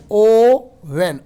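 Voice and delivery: slow ओवन with a long opening ओ; stretched oven. Shown as a demonstration. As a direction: draw out the ओ in ओवन. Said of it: "ओ आ रहा है".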